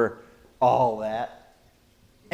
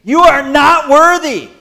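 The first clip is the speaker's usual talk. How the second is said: booming declaration; 11 semitones higher, 12 dB louder than usual. Acted. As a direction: thunder it out high and loud. Preacher's emphatic climax.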